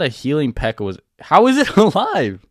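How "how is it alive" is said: high, loud laugh breaking through the words; amazed laugh mid-question; laughing disbelief. Real, not acted. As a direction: crack up in disbelief. Healing P.E.K.K.A survives shockingly.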